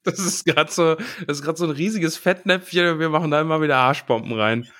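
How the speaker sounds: speaking through laughter; amused, giggly talk; laughing. Real, not acted. Laughing about their blunder.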